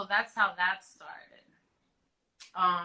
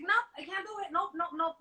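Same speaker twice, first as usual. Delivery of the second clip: much higher, defiant nopes; refusing the doctors at work, high and stubborn; stubborn refusal; 10 semitones higher, 4 dB quieter than usual. Retold or imitated. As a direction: dig your heels in.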